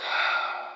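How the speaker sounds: long breathy sigh; audible sigh before speaking. A narrator's reading. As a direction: let out a heavy exhale. Commander pauses, sighs before answering.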